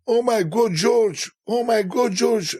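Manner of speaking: high, excited fan shrieks; mock fan screaming; imitating screaming women. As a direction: squeal it like star-struck fans. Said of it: "they were just screaming".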